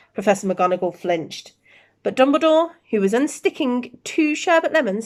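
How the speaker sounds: ordinary delivery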